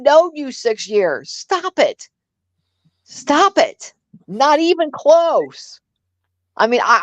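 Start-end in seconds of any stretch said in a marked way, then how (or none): 1.3-3.9 high, put-on scolding "stop it"; repeated mock scold, higher. Mocking the six-years claim. 4.3-5.8 slow, higher, drawn-out dismissal; mock incredulous scoff. Rebutting the six-years claim.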